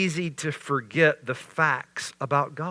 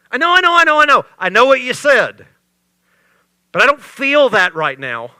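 much higher and louder, frantic "I know, I know"; frightened plea; 9 semitones higher, 10 dB louder, faster than usual. Acted. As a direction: plead in panic against the storm. Panicked disciple pleading with Jesus.

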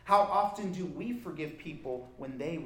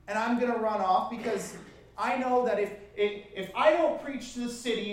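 higher and louder, exasperated; loud, raised-pitch grumbling rant; Jonah's angry complaint; 7 semitones higher, 7 dB louder than usual. Acted. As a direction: hurl it at God like a sulking prophet. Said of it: "he's basically saying".

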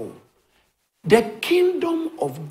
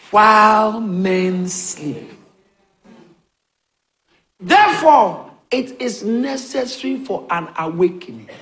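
preaching at full force; 6 semitones higher, 7 dB louder than usual. Acted. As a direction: preach it like a revival. Preaching build-up.

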